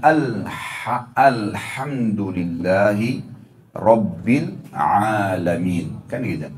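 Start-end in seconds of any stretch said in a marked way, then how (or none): 1.1-6.0 slow, measured recitation of Al-Fatihah; slow careful recitation. Shown as a demonstration. Teacher to congregation, reciting slowly as the model. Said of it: "Kita baca Al-Fatih misalnya".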